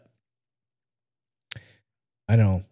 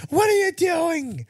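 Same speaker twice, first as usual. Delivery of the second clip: high, slow hyped-up coach; hype coach voice; slower than usual. Pretend. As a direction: pump him up, slow and high. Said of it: "I would like him to be".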